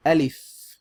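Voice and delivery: Alif said loud and clear, long open first a; Arabic letter name. Shown as a demonstration. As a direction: model the letter name Alif for students to repeat. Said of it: "Repeat after me".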